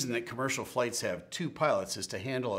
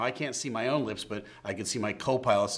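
usual speaking voice

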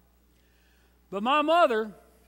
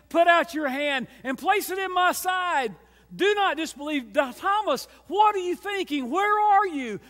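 higher, pleading call; Jesus calling Thomas; 6 semitones higher, 4 dB louder than usual. Acted. Jesus calling out to doubting Thomas, raised and pleading.

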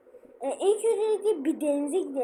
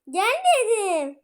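stern command; 6 semitones higher, 6 dB louder, much slower than usual. Real, not acted. Impatient with brother in game.

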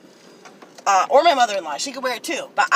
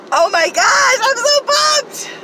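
much higher, loud, slow shout of joy; excited shout; 6 dB louder, slower than usual. Real, not acted. Vlogger at peak thrill over dumpster finds, joyful shout. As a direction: let it all out at the top of your voice.

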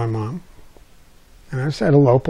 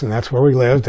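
ordinary talk, nothing marked

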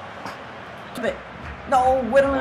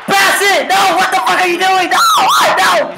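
angry gamer shout; 6 semitones higher than usual. Real, not acted. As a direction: yell at the screen in frustration. Gamer yelling at match.

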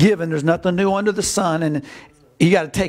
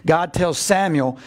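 usual speaking voice

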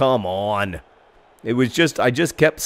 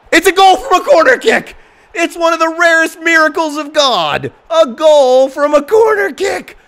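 commentator goal shout; 9 dB louder than usual. Pretend. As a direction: scream the goal like a delirious announcer. Mock commentator on corner goal.

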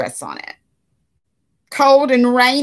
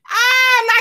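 surprised cry; 5 dB louder than usual. Real, not acted. Reacting to Lucky's mischief.

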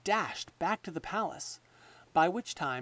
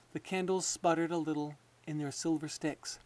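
usual speaking voice

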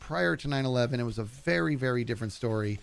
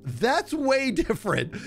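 higher, louder and slow, mock-offended; slow, high insistence that it's different; mock indignant; 10 semitones higher, 4 dB louder, slower than usual. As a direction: drag it out, pretending to be wounded. His own retort to daughter.

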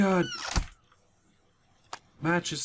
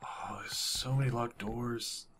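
whisper, barely breathing; a tense, frightened whisper; scared whisper; 3 semitones lower, 8 dB quieter than usual. Real, not acted. Sneaking through house.

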